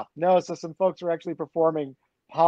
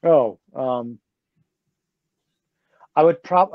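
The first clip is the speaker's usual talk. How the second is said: normal talking voice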